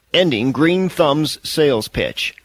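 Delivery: plain reading voice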